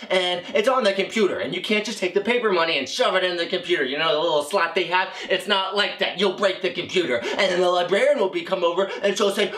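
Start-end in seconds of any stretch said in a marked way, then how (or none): none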